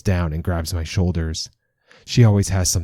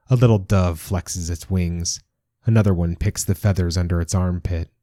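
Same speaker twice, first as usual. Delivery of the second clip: ordinary delivery, nothing marked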